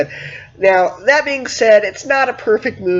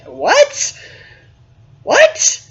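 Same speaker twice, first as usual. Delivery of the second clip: the second what leaps far higher in shocked disbelief; rising double take of disbelief; incredulous what; 11 semitones higher than usual. Retold or imitated. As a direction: react as if you misheard something absurd. Disbelief at clone twist.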